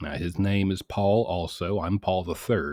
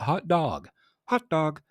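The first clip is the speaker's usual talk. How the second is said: higher mock hot dog; 9 semitones higher than usual. Shown as a demonstration. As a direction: bounce hot dog up like dad.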